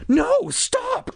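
very high alarmed cry; urgent, high-pitched protest. A narrator's reading. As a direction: cry out to stop someone. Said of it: "Casey cried".

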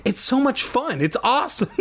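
high, giddy protest breaking into laughter. A narrator's reading. Jack refusing to give up flying, giddy protest. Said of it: "I protested".

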